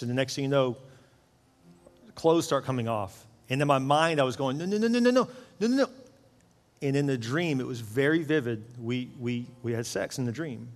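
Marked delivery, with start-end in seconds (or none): 4.6-5.9 higher-pitched pleading no's; alarmed protest. Retold or imitated. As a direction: resist in panic, pitch up. Protesting inside a dream.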